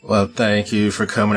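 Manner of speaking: ordinary talk, nothing marked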